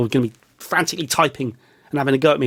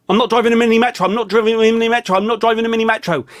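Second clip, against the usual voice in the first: higher and louder, repeated in mounting indignation; indignant rant; 6 semitones higher, 4 dB louder, faster than usual. Pretend. To an imagined heckler about his car, indignant louder rant. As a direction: insist it's not a mini metro, fed up.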